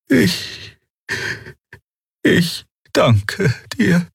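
high, very slow faltering thanks; Xia's broken thanks. A narrator's reading. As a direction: force out the words through emptiness. Xia, empty and falling.

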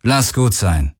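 müde und resigniert, ohne Kraft; matt. A narrator's reading. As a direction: gib auf, leise erschöpft. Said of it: "sagte Akina matt". (Kapitänin redet den jungen Galen resigniert vom Kampf ab)

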